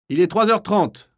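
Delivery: high, loud call; shouted announcement. Acted. Kapo concluding the roll call, shouting. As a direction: call the time out across the block. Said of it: "crie le capot".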